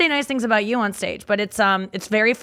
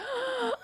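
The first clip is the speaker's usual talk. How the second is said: a sharp, high, breathy gasp, lightly acted; imitated gasp; 13 semitones higher, 12 dB quieter than usual. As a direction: gasp as if at a hot guy on TV. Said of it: "accidentally gasped".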